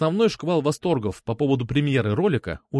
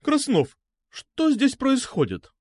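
high, slow, stretched diva tones; operatic indignation; 12 semitones higher, slower than usual. A narrator's reading. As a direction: demand answers like an opera singer. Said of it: "оперного контральта". (оперным голосом, возмущённо)

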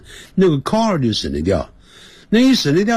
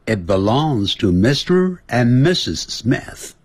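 慢速示范回答; 2 semitones higher, slower than usual. Shown as a demonstration. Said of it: "B就回答了".

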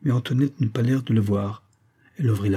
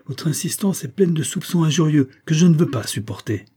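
higher-pitched, flashing indignation; indignant outcry; 7 semitones higher, 3 dB louder than usual. A narrator's reading. Young woman rebuking Jacques over his insulting suspicions, indignant. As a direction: protest hotly, voice lifted. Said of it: "s'écria Antoinette, dont les yeux jetaient des éclairs".

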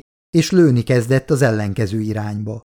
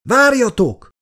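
high urgent shout; desperate high cry; 11 semitones higher, 3 dB louder than usual. Acted. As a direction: yell at them to wait. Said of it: "kiáltott fel Miller".

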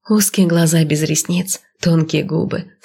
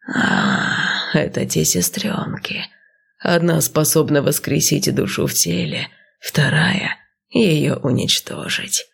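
serpent seer voice; slower than usual. Acted. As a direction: breathe it out like an old serpent. Snake names the sisters.

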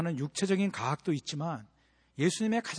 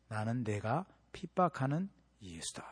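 quiet solemn voice; 6 semitones lower, 8 dB quieter, slower than usual. Acted. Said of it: "예수님 뭐라고 계시냐면".

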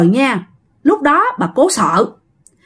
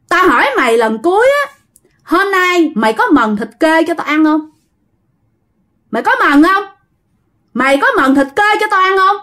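higher-pitched, pressing ultimatum; insistent, raised-pitch demand; demanding spirit; 6 semitones higher than usual. A narrator's reading. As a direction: press the question as a last warning. Spirit gives a last demand.

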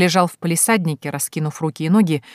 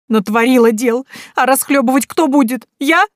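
high, loud weeping reproach; tearful reproach; 7 semitones higher, 5 dB louder than usual. Acted. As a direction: cry out the blame. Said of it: "залилась горючими слезами". (сквозь слёзы, с упрёком)

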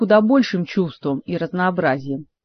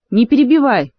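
queen cutting off an interruption, raised voice; sharp raised voice; 5 semitones higher, 5 dB louder than usual. A narrator's reading. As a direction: snap it out, higher and louder. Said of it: "прикрикнула королева". (прикрикнуть, повысив голос)

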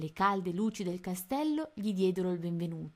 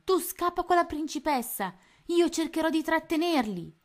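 high, loud command to flee; urgent shout; 10 semitones higher, 6 dB louder than usual. A narrator's reading. Tony telling his friend to run, loud urgent shout. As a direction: shout orders while fighting. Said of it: "gridò Tony".

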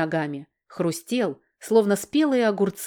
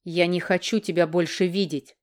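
usual speaking voice

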